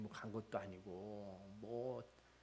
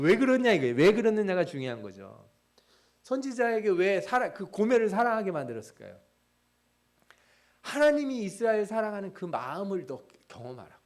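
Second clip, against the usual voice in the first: louder preaching voice, pressing rhetorical questions; impassioned preaching; 7 semitones higher, 10 dB louder than usual. Acted. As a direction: preach it out, challenging the congregation. Preaching at full force.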